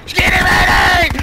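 loud urgent shout across the room. Real, not acted. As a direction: yell it so your buddy hears. Shouting at teammate in game.

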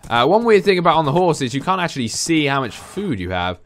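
normal talking voice

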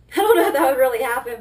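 loud, fast laughing speech. Real, not acted. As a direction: blurt it out through a laugh. Laughing at own fear.

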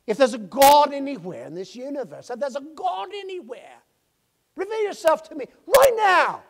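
high loud pleading; desperate plea. Retold or imitated. Young man pleading for God to reveal himself, high. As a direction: plead with the sky.

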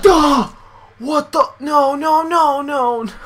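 high, loud cries of alarm; panicked yelling. Real, not acted. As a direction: yell frantic, repeated no's. Panicking under attack.

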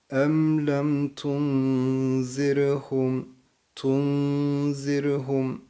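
Tunzirhum with n held in the nose, very slow; slow tajweed, nasal n; nasal nun. Shown as a demonstration. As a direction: chant Em lem tunzirhum, humming the n. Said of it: "Nun harfini bakın genzimizde tutuyoruz".

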